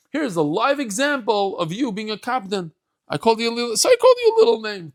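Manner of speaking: climbs high and loud; gleeful teasing, rising to a cry; teasing exclamation. Acted. [name] mocks Elijah's temper.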